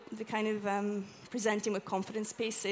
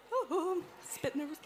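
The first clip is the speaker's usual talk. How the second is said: light, higher, breathy-free mock jitters; mock nervous; 7 semitones higher, 4 dB quieter than usual. Shown as a demonstration. Walking toward the audience, admitting mock nerves. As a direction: play at being nervous as you approach. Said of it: "you know, going".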